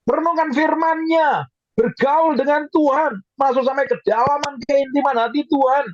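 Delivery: high, urgent exhortation; preaching at full force. Real, not acted. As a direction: scold and urge the congregation, pitched high. (khotbah menggebu-gebu, nada tinggi)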